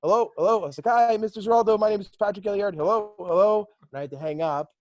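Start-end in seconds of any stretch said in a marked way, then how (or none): 0.0-0.6 louder, higher retold phone hello; calling into phone. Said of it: "you couldn't hear me". 0.9-3.7 higher, louder, trying to get through a bad line; calling into phone. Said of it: "you couldn't hear me".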